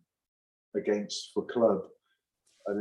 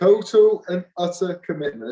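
high and loud, stressing Total; emphatic repeat; 8 semitones higher, 8 dB louder than usual. Real, not acted. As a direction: drive home Total as a rallying call. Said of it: "says phrase again, insistent".

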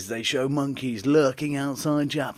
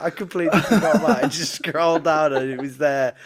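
ordinary talk, nothing marked

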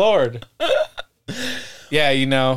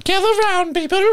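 high, hammed-up call to gather; calling the family in like a crier; mock town crier; 14 semitones higher than usual. [name] calling the family round for news, high mock crier.

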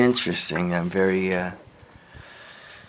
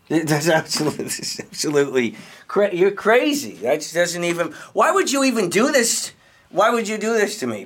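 rising higher into indignant outrage; outraged disbelief; 7 semitones higher than usual. Acted. Accused by the podcaster, he erupts in outraged disbelief. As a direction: build from stunned to shrill disbelief.

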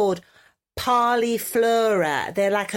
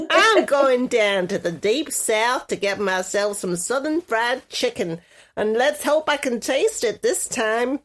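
put-on southern US accent. Podcaster playing along with her co-host, southern US accent. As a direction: play it as a Southerner craving home cooking. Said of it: "You're going southern now".

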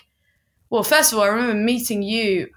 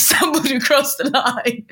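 laughing; 6 semitones higher, 3 dB louder than usual. Real, not acted. Laughing retelling finish line.

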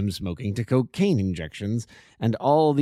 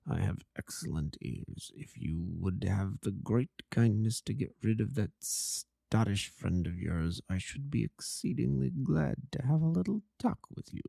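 much softer, kept low and secretive; hushed voice; 7 dB quieter than usual. Acted. Holmes quietly instructs Watson in the opium den, hushed. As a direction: murmur so the den cannot overhear. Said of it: "As low as you can".

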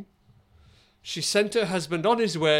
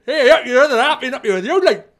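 put-on loud, higher broad northern English accent; 9 semitones higher, 12 dB louder, faster than usual. Boss greeting the wife on her walk, loud hammed-up northern accent. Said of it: "It was quite northern".

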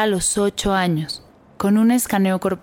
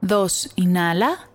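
slow breath counting; 3 semitones lower, slower than usual. Acted.

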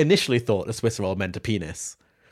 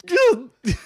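high squealing laugh; a helpless high shriek of laughter; 4 dB louder than usual. Real, not acted. Reacting to absurd fan fiction.

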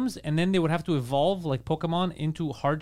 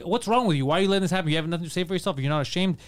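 normal talking voice

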